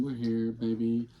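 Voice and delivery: ordinary talk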